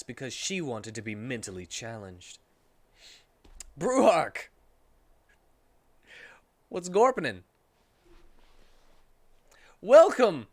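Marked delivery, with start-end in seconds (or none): 3.8-7.4 loud, goofy gargled voice; silly creature voice. Acted. Narrator goofing off mid-story, loud goofy voice. 9.8-10.5 loud, high hammed greeting; excited welcome. Real, not acted. Host greeting listeners mid-story, loud excited voice. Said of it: "greeting viewers".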